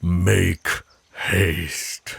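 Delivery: a werewolf's final warning; commanding order. Acted. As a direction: push her out of the forest. Ordering elf out of forest.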